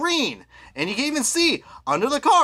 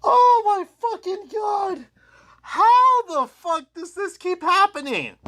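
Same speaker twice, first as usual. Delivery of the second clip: exasperated rant; 11 semitones higher than usual. Real, not acted. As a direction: rant in disbelief at the same flaw again. Ranting about repeated defect.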